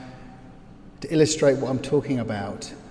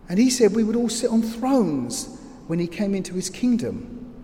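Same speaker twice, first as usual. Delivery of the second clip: higher, searching voice of a shaken follower; bewildered disciple; 6 semitones higher than usual. Acted. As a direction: speak as a disciple who can't believe it. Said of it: "And you're there, hiding in the crowd".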